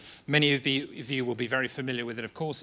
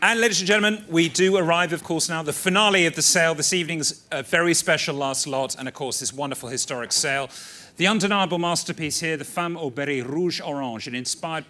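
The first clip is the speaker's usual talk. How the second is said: ringing, raised showman's announcement to the room; grand announcement; 4 semitones higher, 7 dB louder than usual. Real, not acted. Auctioneer presenting the final lot to the room, grandly. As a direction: announce the star lot with ceremony.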